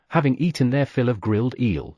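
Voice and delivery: ordinary delivery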